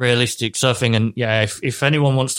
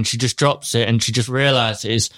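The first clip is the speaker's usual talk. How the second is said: ordinary talk, nothing marked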